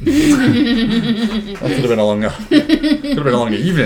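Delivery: high, loud, slow laughing remark. Real, not acted. Winding up the accent detour, laughing high and slow. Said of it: "laughing at the silly detour".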